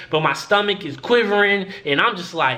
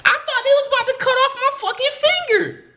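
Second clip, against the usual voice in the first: much higher, fast panicked squeal; mock panic; faster than usual. Real, not acted. Thinking doctors will cut off his finger, panicked squeal. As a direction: shriek it like your finger's about to go.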